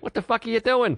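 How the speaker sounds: high-pitched imitation of an angry comic; enraged comic. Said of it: "he's, like, enraged".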